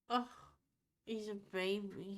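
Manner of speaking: normal talking voice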